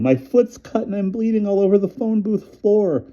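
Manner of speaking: very high, wailing panic; woman wailing to a doctor about her bleeding foot. A narrator's reading. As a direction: sob out the words in panic. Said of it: "I heard myself wailing".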